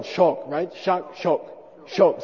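shok with a low tone. Shown as a demonstration. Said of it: "that's low tone".

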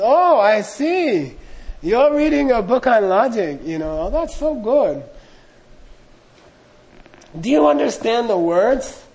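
mocking old hag. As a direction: tease him like a sly old witch. Hag voiced mocking the monk.

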